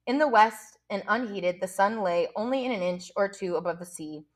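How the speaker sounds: ordinary delivery, nothing marked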